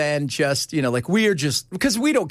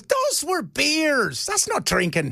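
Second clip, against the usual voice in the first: slow, high, indignant Irish husband; mock Irish accent, indignant; 9 semitones higher, much slower than usual. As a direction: drag it out like a stubborn Irish drinker. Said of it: "He goes".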